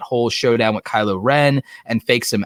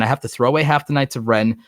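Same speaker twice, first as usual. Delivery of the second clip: ordinary talk, nothing marked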